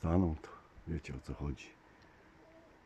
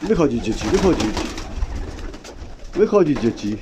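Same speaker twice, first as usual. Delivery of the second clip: calling out; 2 semitones higher, 6 dB louder than usual. Real, not acted. Coaxing pigeons out of the loft.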